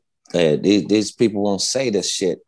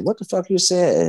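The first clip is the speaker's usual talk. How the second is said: much higher, challenging mock demand; retold confrontation; 11 semitones higher than usual. Player confronting a heckling fan, much higher challenging demand. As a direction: square up to the heckler. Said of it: "walked up there and said".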